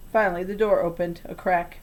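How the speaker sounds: ordinary delivery, nothing marked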